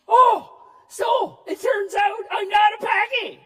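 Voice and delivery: high, loud triumphant outburst; high, loud gleeful discovery; excited realization. Retold or imitated. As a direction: shout it like a kid who just figured it out.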